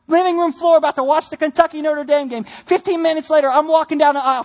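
ordinary delivery

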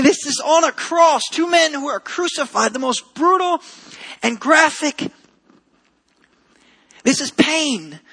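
preacher climbing to the cross image; impassioned preaching. Acted. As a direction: press the point harder with each line. Preaching builds in force.

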